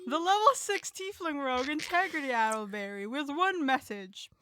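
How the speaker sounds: normal talking voice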